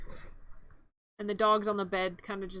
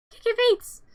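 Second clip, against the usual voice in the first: much higher, louder cutesy cry; playful squeal; 11 semitones higher, 8 dB louder than usual. Pretend. Narrator delights in the puppet's kicking feet, squealing. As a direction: squeal it like baby talk.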